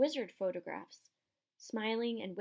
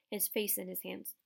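usual speaking voice

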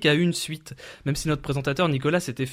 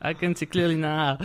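slow, exaggerated French-accented English; mock French accent in English. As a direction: parody his French accent. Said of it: "accent anglais caractéristique que l'on retrouve chez les Britanniques du Sud".

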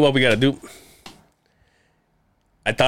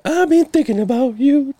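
much higher, playful crooning of "you"; sing-song come-on, pitched way up; mock flirty sing-song; 4 dB louder than usual. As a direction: tease him like a lovesick crooner. Teasing flirty aside to cohost.

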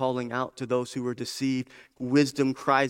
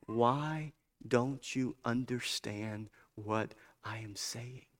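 hushed, slow quotation; 8 dB quieter, much slower than usual. Acted. Preacher quoting Jesus in John eight, hushed and slow. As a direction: let it fade almost to nothing. Quotes Jesus slowly and softly.

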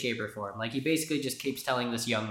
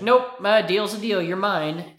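louder, higher, smug refusal; mocking cruel author; 5 semitones higher, 7 dB louder than usual. The old author refusing to free the muse, smug and loud. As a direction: toss off the refusal with a sneer.

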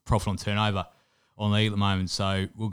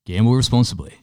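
loud, punchy betting-ad sign-off; hyped betting ad; 8 dB louder than usual. Acted. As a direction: belt it out like an ad tagline. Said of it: "do you wanna close us out?".

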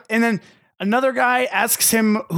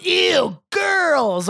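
put-on grossed-out kid; 8 semitones higher than usual. Voices disgusted ten-year-old.

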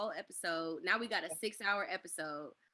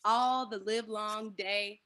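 slow, stretched and loud; drawn-out; 5 dB louder, much slower than usual. Acted. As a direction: stretch every word out for the joke. Drawn out for comic effect.

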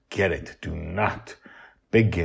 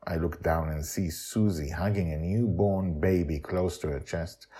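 plain reading voice

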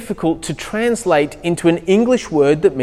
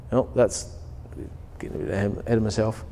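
quiet aside; 8 semitones lower, 12 dB quieter, faster than usual. Real, not acted. Aside correcting himself.

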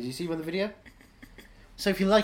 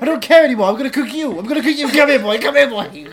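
loud, high, cackling menace; crazed laughing mother; 9 semitones higher, 13 dB louder than usual. Acted. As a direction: scream it with manic glee. Mother turning cannibal, laughing loudly.

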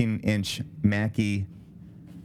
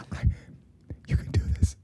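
whisper; 4 semitones higher than usual. Pretend. Encouraging singer from background.

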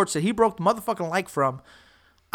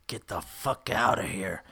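much lower, slow and soft; retold menacing dismissal; 12 semitones lower, 9 dB quieter than usual. Rival tells him to back off, low menacing dismissal. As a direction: drop low and draw out the warning.

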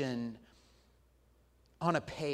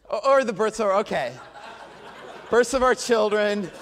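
laughing; 4 semitones higher, 8 dB louder than usual. Real, not acted.

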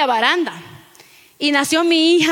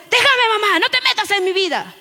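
adolescente rebelde imitado; 7 semitones higher than usual. Retold or imitated. Adolescente contesta a su madre.

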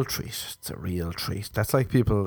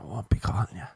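breathy whispered repeat; 4 dB quieter than usual. Acted. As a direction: whisper it like a secret.

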